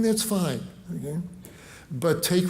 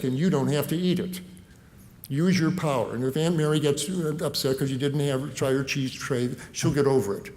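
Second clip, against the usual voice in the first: ordinary talk, nothing marked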